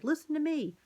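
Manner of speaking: high, loud shout; as loud as he could. A narrator's reading. Mouse shouting at Garf, high and loud. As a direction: make the boy hear you. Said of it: "Ralph said as loud as he could".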